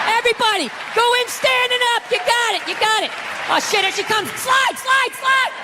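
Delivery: high, excited coach calls; third base coach. Acted. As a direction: wave the runners home, rising to a shouted "slide". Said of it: "was a third base coach".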